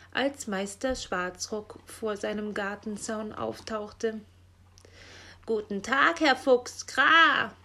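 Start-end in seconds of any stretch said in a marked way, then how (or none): 5.4-7.5 louder, rising into a loud caw; cawing raven greeting. A narrator's reading. Raven hailing Herr Fuchs over the fence, loud cawing greeting.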